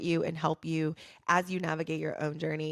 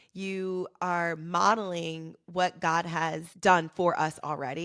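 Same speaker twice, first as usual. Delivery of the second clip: ordinary talk, nothing marked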